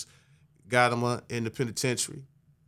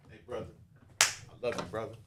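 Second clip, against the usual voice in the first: tender reassurance; 8 dB quieter, much faster than usual. Shown as a demonstration. Man showing a brother he is loved, tender reassurance. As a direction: murmur it gently, close and sincere. Demonstrates embracing a struggling brother.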